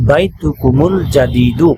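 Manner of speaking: slow বাইতুকুমুল with মিম carrying dhamma into আল; teacher demonstrating the joined reading for students; joined Arabic reading. Shown as a demonstration. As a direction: link বাইতুকুমুল জাদিদু slowly as one flow. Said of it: "মিলিয়ে পড়ার জন্য".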